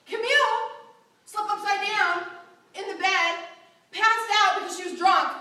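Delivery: embellished retelling. Retold or imitated. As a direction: punch up the rumour as it spreads. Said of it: "as my friend pointed out".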